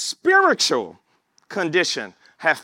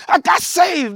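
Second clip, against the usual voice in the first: higher, louder eager call; excited announcement; 11 semitones higher, 5 dB louder than usual. Retold or imitated. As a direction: burst out the news to the family. Telling relatives the news.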